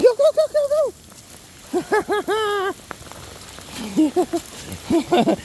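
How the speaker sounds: high, sing-song urging of the dog; excited dog call. Real, not acted.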